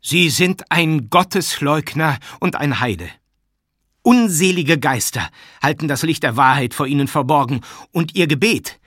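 high, loud preacher-like accusation; loud, annoyed denunciation. A narrator's reading. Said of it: "sprach Matvei laut weiter". (laut anklagend, erregt)

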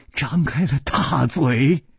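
plain reading voice

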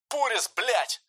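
high yelled call over the radio; shout over radio. Acted. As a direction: yell it up high into the helmet mic. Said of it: "кричит в динамик Себель".

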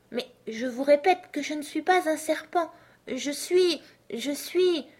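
high-pitched protest that breaks off; faltering protest. A narrator's reading. Alice unsure how to tell the pigeon what she is, faltering.

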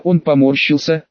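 plain reading voice

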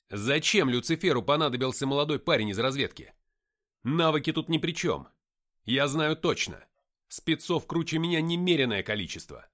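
loud, insistent argument; heated questioning. A narrator's reading. Narrator questioning why Lucifer needs him, heated insistence. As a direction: press him hard for an answer.

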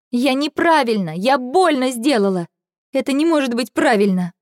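high, anguished outburst; distressed protest. A narrator's reading. Girl confessing she hurt someone, distressed protest.

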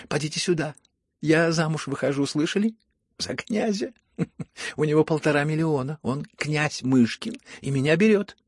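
high, loud and elated; excited announcement. A narrator's reading. Bride tells her maids of the prince, excitedly. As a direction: proclaim it with a laugh breaking in.

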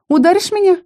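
very high, taunting question; goading, high-voiced provocation; defiant challenge. A narrator's reading. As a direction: dare him with a sharp rise in pitch. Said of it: "С вызовом поинтересовалась".

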